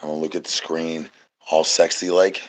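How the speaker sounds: hammed-up sultry murmur; mock sexy low voice. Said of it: "I'm gonna talk real low".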